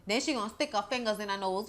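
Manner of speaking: ordinary talk, nothing marked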